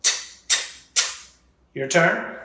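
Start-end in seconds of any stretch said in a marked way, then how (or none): 0.0-1.0 "T" as a crisp voiceless tap, not the letter name; short clipped "t" bursts; isolated T sound. Shown as a demonstration. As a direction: tick out each "t" separately. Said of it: "Now the T sound".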